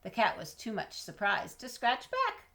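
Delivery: plain reading voice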